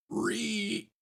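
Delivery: high squealing pig grunt, quickly cut off; zombie pigman squeal. Shown as a demonstration. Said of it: "let's see if I can give a good".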